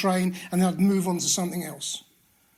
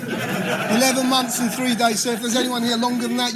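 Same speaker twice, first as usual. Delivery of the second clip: laughing; 4 semitones higher, 4 dB louder than usual. Real, not acted. Joke about clean time.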